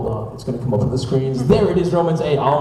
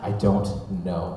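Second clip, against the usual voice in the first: low, slow deadpan answer; dead serious; 8 semitones lower, 6 dB quieter, slower than usual. Retold or imitated. Friend stumped by all these questions, dead serious. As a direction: softly imitate a solemn, clueless kid. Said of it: "like, dead serious".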